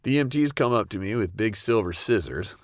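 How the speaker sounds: normal talking voice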